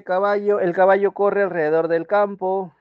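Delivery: ejemplo leído más alto y agudo; lectura de oración. Shown as a demonstration. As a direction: lee la frase marcando caballo. (lectura enfática de ejemplo)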